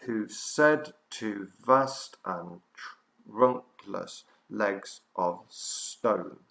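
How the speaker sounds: very slow, separated syllables; slow counting delivery, each syllable on its own. Shown as a demonstration. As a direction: spell out the line beat by beat for counting. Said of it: "Here we go again".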